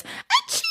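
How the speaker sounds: very high, squeaky put-on sneeze; mocking a schoolmate's showy sneeze; fake loud sneeze. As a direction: mimic a sneeze done for attention. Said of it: "who sneezed like this".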